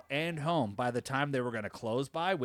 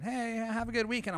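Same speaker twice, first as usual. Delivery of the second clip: chirpy coworkers; 11 semitones higher than usual. Retold or imitated. Coworkers say goodbye.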